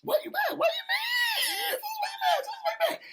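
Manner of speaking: squealed high through laughter; laughing, high-pitched taunting of a pouting man; mocking laughter. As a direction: tease him, cracking up mid-word.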